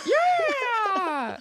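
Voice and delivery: high, loud belted yeah; mock rock shout. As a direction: bellow it back like a crowd. Answers mock anthem yell.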